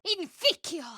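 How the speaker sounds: high, biting spell cry; spell incantation. Acted. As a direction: spit the curse out. Casting a spell in combat.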